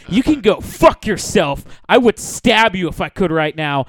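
acted-out stripper's outburst; retold angry threat. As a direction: hit each threat hard. Stripper turns on him, acted.

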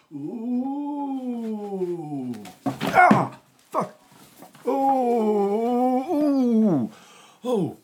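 high swooping wail with loud yelps; homemade landing effect. Pretend. Storyteller does the moon landing himself, high wailing whoops. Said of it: "I'm gonna do the effect myself".

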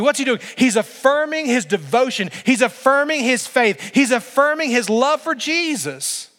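high, loud, driving repetition; fervent pulpit cadence; full-force preaching. Real, not acted. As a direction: build the point like a sermon climax.

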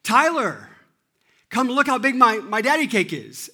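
put-on high, loud kid calling a sibling over; yelling out. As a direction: holler excitedly across the house. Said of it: "she turns and she yells out".

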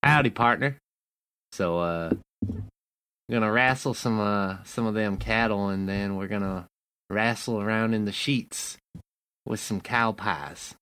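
mosey through it like a sleepy ranch hand; cowboy voice. Shown as a demonstration. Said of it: "your best cowboy voice".

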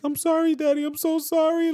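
put-on very high childlike apology; imitating sorry son. Dad mimicking his son apologizing, very high and childlike. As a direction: plead like a tearful toddler. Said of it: "he's like".

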